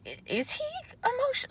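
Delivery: high, puzzled inner voice; high puzzled musing; wondering aloud. Retold or imitated. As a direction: squeak out the question in disbelief. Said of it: "I'm like".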